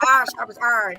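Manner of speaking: "iron" said as "arn", clipped Southern Fort Worth style. Shown as a demonstration. Host showing cohost how Fort Worth says iron. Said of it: "That's how we would say".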